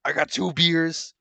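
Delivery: a light mock Stone Cold, second line slowed; rivals' host mocking Stone Cold's beer shtick in a put-on voice. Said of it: "He doesn't need to be like".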